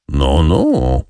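unbothered, drawn-out no no; phlegmatic. A narrator's reading. Monte Cristo calmly mocks the furious general, phlegmatic. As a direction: brush him off coolly. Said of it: "z taką samą irytującą flegmą".

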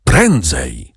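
low, restrained urging; silent urging. A narrator's reading. Old man's eyes urging Maksymilian upstairs, restrained urgency. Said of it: "zdawały się wołać".

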